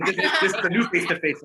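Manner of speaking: high, loud, laughing delivery. Real, not acted. Joking with the group about meeting face to face, laughing. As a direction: say it through real laughter.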